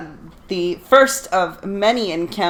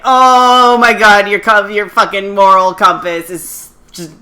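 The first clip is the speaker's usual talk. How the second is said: mock exasperation; 3 semitones higher, 7 dB louder than usual. Viewers reacting to Suzaku's moral compass, exasperated mock wail. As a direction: wail in frustration at a hopeless character.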